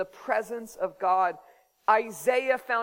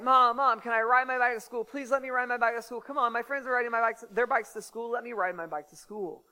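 put-on higher, fast nagging kid voice; pleading kid; 4 semitones higher, faster than usual. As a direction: pester mom with rapid-fire pleas. Child begging mom, retold.

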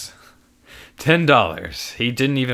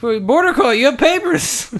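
very high, laughing triumph; triumphant laugh; 13 semitones higher, 5 dB louder than usual. Acted. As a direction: crow with laughing glee.

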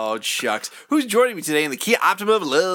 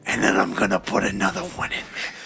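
barbarian. Acted. Threatening bit, then a battle cry.

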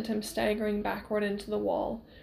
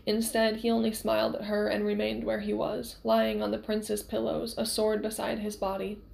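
ordinary delivery

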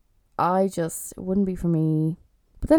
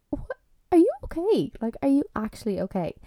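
incredulous; 7 semitones higher than usual. Retold or imitated. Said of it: "I'm like".

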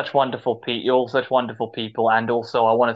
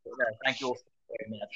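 very soft, hurried thanks; soft quick thanks; 13 dB quieter, much faster than usual. Real, not acted. Quiet fast thanks.

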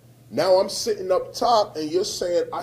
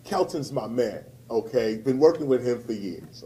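usual speaking voice

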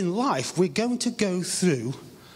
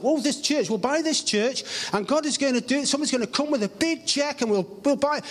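pitched-up eager retelling; lightly mocking his own past eagerness, higher; excited hope; 8 semitones higher than usual. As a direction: gush it like you're sure the money's coming. Retold own hopeful thought.

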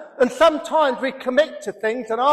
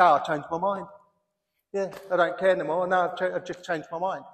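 careless shrug; 5 semitones lower, 8 dB quieter, much faster than usual. Retold or imitated. Imitates careless modern person.

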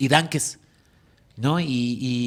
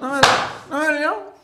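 mock defiance; 11 semitones higher than usual. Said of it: "¿No te dolió?".

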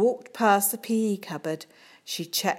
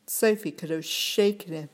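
ordinary delivery

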